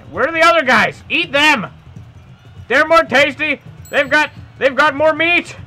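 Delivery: high, loud, urgent coaxing; mock frantic plea. Player urging the dinosaur to eat others, mock frantic plea. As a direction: shout it high, frantically redirecting.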